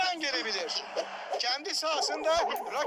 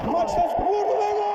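rising, loud goal call; screaming football commentator; 4 semitones higher, 6 dB louder than usual. Acted. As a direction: yell it as the ball goes in. In-game commentator calls a goal. (heyecanlı maç spikeri)

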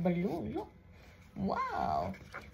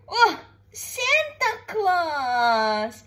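slow, drawn-out excited exclamation; excited Santa Claus; 5 semitones higher, 6 dB louder, much slower than usual. Real, not acted. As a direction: stretch it out in delight. Showing toddler a picture.

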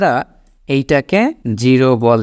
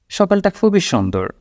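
ordinary talk, nothing marked

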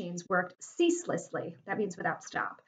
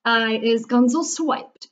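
slow, loud shout; shouting giant; 2 semitones higher, 7 dB louder, slower than usual. A narrator's reading. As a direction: shout it out, stretching the words. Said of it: "shouted the meat pepper".

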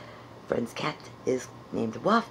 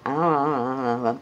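louder, committed munching; loud chewing sounds; 6 dB louder, faster than usual. Shown as a demonstration. Puppeteer commits to the chewing after the note, munching loudly. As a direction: chomp like you mean it. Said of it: "do it like you mean it".

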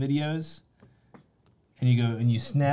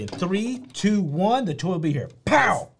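high, punchy countdown announcement; showy demo; 5 semitones higher than usual. Shown as a demonstration. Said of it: "You gotta do it better than that. Say".

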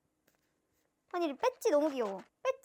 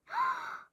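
high, sharp gasp of delight; high-pitched intake of breath; amazed gasp. Real, not acted. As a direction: gasp in thrilled surprise. Reacting to a photo card.

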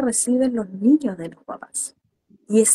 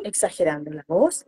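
ordinary delivery

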